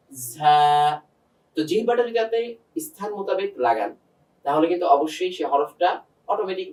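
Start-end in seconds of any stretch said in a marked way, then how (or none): 0.1-0.6 ঝা drawn out with a buzzing z; demonstrated za. Shown as a demonstration. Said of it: "এভাবে". (ঝা টেনে, জিহ্বা নিচের দাঁতে)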